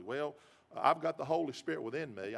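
ordinary talk

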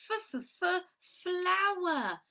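high-pitched f, f, f, then FLOWER; repeated initial sound. Shown as a demonstration. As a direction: bounce on the f before saying FLOWER. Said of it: "So what's today's sound?".

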